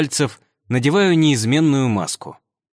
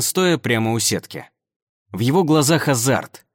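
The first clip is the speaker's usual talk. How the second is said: plain reading voice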